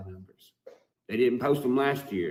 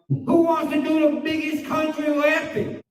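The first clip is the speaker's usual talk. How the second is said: very high, louder hammed-up host; mock a cheesy event host; mock announcer; 7 dB louder than usual. Rapper mocking rivals' event, high hammed-up host.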